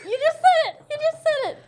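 high, laughing insistence; excited exclamation. Real, not acted. Friend telling player she just said the answer, high excited squeal. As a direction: squeal it twice through laughter.

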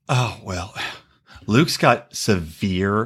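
slow, low, drawn-out mock of a self-pitying woman; Hannah impression. Mocking Hannah on the phone to Dez, slow and low. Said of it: "can we talk about Hannah going".

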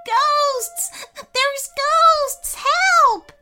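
very high, loud panicked shriek; terrified cry. A narrator's reading. Frightened boy runs from ghosts, shrieking. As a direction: scream for help in terror. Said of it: "Daniel started crying".

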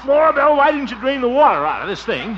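high, loud indignant protest; loud, high exasperated complaint. Acted. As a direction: yell up the stairs at her. Complains after soaking.

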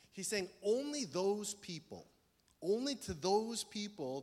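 preacher's slow, pointed stress on only; slow emphasis. Real, not acted. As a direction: lean hard on only, slowly. Preacher stressing who is included.

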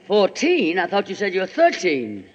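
normal talking voice

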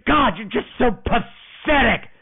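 lightly voiced contempt; mock disgusted cheerleader. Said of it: "she goes".

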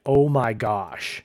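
drawn-out, slow, awed oh my gosh; slow amazed gasp. Retold or imitated. Podcaster quoting the chapter-ending line, slow awed exclamation. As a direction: savor it like a cliffhanger reveal. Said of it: "starts with something like".

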